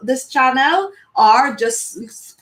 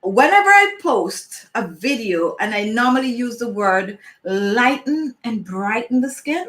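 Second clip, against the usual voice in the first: ordinary talk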